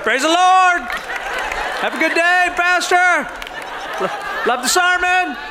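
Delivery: a hint of a sheepish churchgoer fawning over the pastor; mock pious driver. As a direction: play the suddenly holy road-rager, eager to please. Driver greets his pastor.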